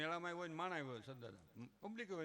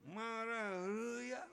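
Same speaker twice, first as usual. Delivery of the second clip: drawn-out, higher mock-threatening mother; angry mother; 5 semitones higher, much slower than usual. Mother raising her hand at the child, slow drawn-out mock anger. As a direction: stretch it slowly as the hand goes up. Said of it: "માં મારે ને માને રીહ હોય".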